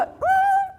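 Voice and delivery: very high sudden ah; shrill startled cry; startled shriek. Retold or imitated. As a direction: yelp in alarm.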